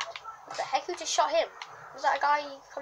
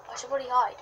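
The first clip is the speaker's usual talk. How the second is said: ordinary talk, nothing marked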